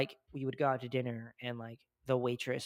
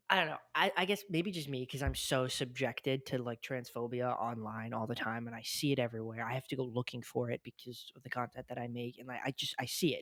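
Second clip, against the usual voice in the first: usual speaking voice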